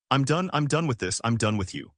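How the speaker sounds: ordinary delivery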